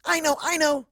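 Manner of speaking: bursting to share the idea; eager. Acted. Narrator blurts out her idea, high and eager. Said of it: "I say".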